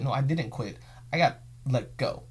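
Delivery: normal talking voice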